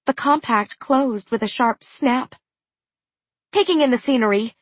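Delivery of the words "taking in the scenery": rushed, fast excuse; quickly. Acted. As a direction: blurt it out fast. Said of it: "said Aru quickly".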